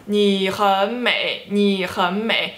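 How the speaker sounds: 你很美 slowly, each syllable a third tone; practice-drill 你很美, stretching each tone; three third tones. Shown as a demonstration. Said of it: "third tone for three times".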